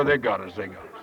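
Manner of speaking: low, sinking defeated voice; mock despair. Woman from the rally gives up, mock despair. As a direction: let it die away hopelessly, hammed-up.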